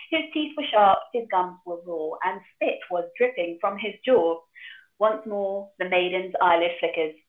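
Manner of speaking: ordinary talk, nothing marked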